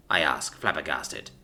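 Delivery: ordinary delivery, nothing marked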